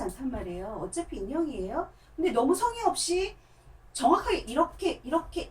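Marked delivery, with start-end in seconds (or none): none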